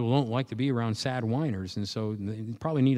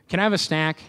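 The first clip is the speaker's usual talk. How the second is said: put-on higher, louder pleading child voice; whiny kid; 6 semitones higher, 7 dB louder, faster than usual. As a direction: play the kid nagging for a snack. Kid badgering parent for snacks.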